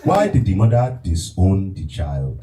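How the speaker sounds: slow, commanding Nigerian dad voice; slow mock-stern Nigerian father; impression of his father. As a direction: order him like a stern father. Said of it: "the impression of your father".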